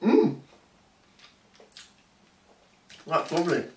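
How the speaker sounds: talking after a bite; chewing with mouth closed. Real, not acted. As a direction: mouth partly full. Seen 0: Took bites just before; holds pie, mouth closed, looks chewing.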